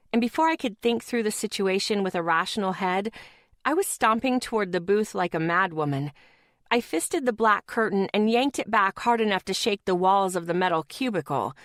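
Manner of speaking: usual speaking voice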